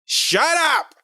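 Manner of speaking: high-pitched mock "shut up" yell; imitated yell. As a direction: snap it high, imitating the singer onstage. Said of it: "kinda yelled out, and she goes".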